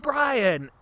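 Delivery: high, loud chanted name; crowd cheer. Retold or imitated. Crowd cheering for Brian, loud high shout.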